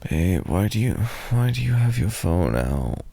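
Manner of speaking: usual speaking voice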